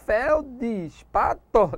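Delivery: "Feldespato" very slowly in four beats, fel, dis, pa, to; "Feldespato" broken up slowly as a doubtful suggestion; wrong syllable split. Shown as a demonstration. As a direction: stretch and separate each syllable of "Feldespato". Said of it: "Seria isso?".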